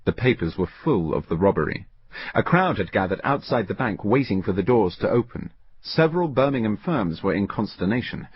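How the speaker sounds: usual speaking voice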